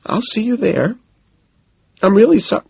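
put-on high-pitched girlfriend regaining control; composing herself. A narrator's reading. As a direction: pull yourself together after crying. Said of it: "composing herself".

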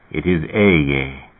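low, very slow divine announcement; solemn deity. A narrator's reading. God speaks from the fire, solemn and slow.

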